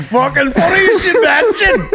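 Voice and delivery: high, loud, laughing through the words; laughing mock reply. Mocking his friend's reply to the TSA lady, laughing and loud. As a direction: cackle it out, half-shouting.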